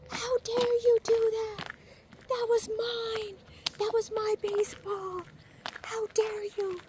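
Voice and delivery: very high, indignant shrill-pitched scold; mock scolding lady. Lady scolding the man who took her baseball, in a very high mock-indignant voice. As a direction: shriek it like an outraged lady. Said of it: "scolding the guy".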